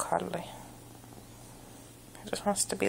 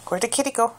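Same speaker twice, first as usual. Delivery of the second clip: put-on playful baby talk; 4 semitones higher, 7 dB louder, much faster than usual. Peekaboo game with cat.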